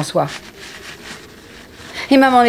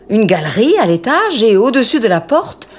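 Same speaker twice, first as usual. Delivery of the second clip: ordinary delivery, nothing marked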